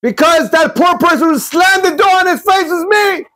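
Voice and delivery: high, shouted triumphant revelation; high exclaiming voice, shouting that it was him; new husband's reveal. Retold or imitated. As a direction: proclaim it like the beggar who came out on top. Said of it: "And the new husband says".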